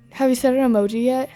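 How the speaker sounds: normal talking voice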